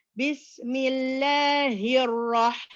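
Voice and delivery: slow "Bismillahirrah-" with running air on ha; breathy ha. Shown as a demonstration. Teacher models airflow on ha for sisters. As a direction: breathe out through the ha slowly. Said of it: "That's for the letter ha".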